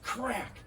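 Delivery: high snapped crack; sharp gunshot crack. Shown as a demonstration. Imitating gunshot.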